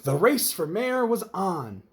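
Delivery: usual speaking voice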